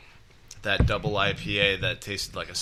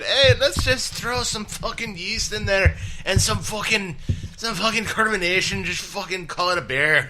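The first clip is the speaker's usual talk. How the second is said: botched Scottish brogue, much higher; bad Scottish accent; 10 semitones higher than usual. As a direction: ham up a terrible Scottish accent. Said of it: "That's terrible Scottish accent".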